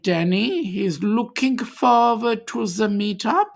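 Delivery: hammed-up German accent, starting high and loud. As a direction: put on a mock German accent with a big opening. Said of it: "put on any other accents".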